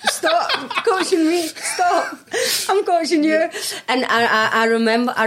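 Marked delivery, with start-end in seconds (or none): 0.1-3.5 high, laughing mock outburst; laughing mock protest. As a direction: protest through laughter, pitched up. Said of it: "I had to be like".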